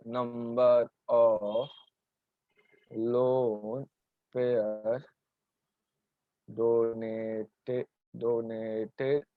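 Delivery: low, drawn-out words with long gaps; very slow dictation. Shown as a demonstration. Teacher dictates the definition for students to write, very slowly. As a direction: dictate word by word, stretching each one out low. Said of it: "कछुए की तरह एकदम slow बोलता हूँ".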